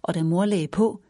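plain reading voice

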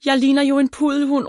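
high-pitched wail of dismay; upset cry. A narrator's reading. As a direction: cry out in horrified protest, drawing out the first word. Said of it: "råber hun, mens tårerne triller".

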